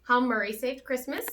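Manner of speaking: ordinary delivery, nothing marked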